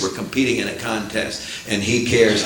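normal talking voice

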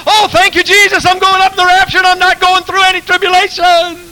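very high, loud jubilant shout; preacher acting his own shout at camp meeting, very high and loud; mock shouting praise. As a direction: shout it out like a revival. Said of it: "they're shouting".

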